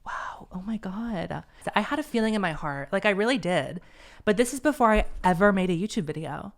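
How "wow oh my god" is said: whispered in awe; awed whisper. Real, not acted. Vlogger stunned by her own letter, awed whisper. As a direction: breathe it out, stunned.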